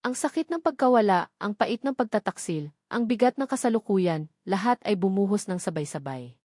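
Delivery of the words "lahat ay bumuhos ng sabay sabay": ordinary delivery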